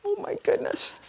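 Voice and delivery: high gasping surprise; shocked gasp. A narrator's reading. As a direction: gasp then exclaim. Reader shocked at picture.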